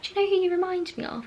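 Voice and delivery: high, quick imitation of an eager friend; excited retold question. Librarian friend teasing a comparison to the reader, excited retold question. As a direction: ask it fast and high, as if bursting to tell.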